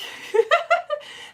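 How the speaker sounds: high burst of giggling; real, high-pitched laugh. Real, not acted. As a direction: giggle freely, pitched well up. Laughing while reading messages.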